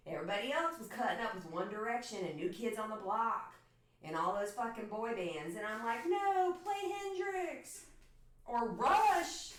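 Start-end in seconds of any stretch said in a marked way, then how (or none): 6.1-9.5 weird voice inflection. Retold or imitated. Telling off boy-band fans in a high, dragged voice. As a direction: pitch it way up and drag the band names. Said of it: "that weird voice inflection with Rush".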